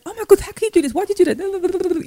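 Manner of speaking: high shrieking mock outrage; frantic high-pitched scolding; losing it. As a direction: replay a mother losing her temper at her child. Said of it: "I'm looking at her like".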